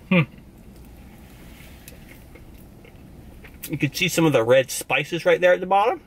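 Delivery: chewing while talking; talking between bites; mouth full. Real, not acted. Seen 0: Bites sandwich at +1.8, chews through +4.1, holds food throughout.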